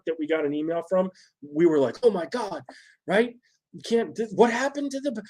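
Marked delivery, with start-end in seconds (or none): 2.0-5.2 high, alarmed exclamations rising higher; mock panic. Sailors far from home reading alarming email, high panicked outburst. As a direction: act out the sailors' panic over bad news, voice climbing.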